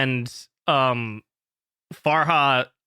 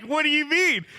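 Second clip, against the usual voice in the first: laughing; 12 semitones higher than usual. Retold or imitated. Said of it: "I'm like".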